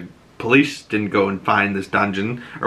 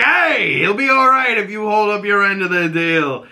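mock shout; 9 semitones higher than usual. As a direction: shout a promise across the room. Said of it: "He's like".

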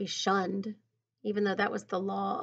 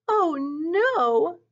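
higher, drawn-out cry of dismay; calling out; 6 semitones higher, 4 dB louder than usual. A narrator's reading. Naomi sees Fancy escape, calling out in dismay. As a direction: call it out in dismay, pitched up. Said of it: "Naomi called out".

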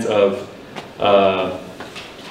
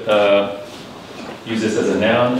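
ordinary talk, nothing marked

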